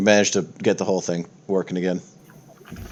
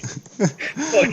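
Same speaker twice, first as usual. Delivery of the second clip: high giggle. Real, not acted. GM laughs at the bullying.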